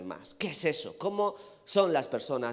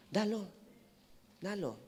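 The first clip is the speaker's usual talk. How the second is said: soft, higher echoes of a command; gentle urging; 5 semitones higher, 11 dB quieter than usual. Acted. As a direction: drop the volume and let each word hang. Gently repeats the Lord's command.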